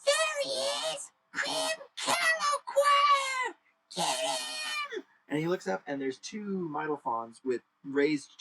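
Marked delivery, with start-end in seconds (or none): 0.0-5.0 very high, shouted alarm in a strange voice; distant shout. Acted. Attacker spotting the thief, shrieking a high alarm. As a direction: yell from far down the pier. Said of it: "you hear".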